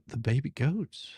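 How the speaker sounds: ordinary delivery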